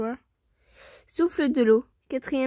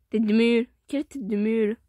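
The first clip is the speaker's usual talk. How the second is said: plain reading voice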